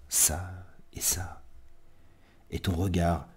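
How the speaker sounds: hushed, halting poetry reading; quiet, tender love-poem recital; intimate poem recitation. Acted. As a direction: let the words sink toward a whisper between long pauses.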